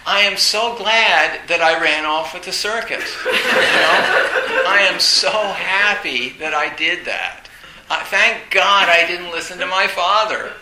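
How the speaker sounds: loud, laughing mock celebration; crowing with laughter at his own escape. Real, not acted. Teacher mocking the circus jibe, laughing loudly.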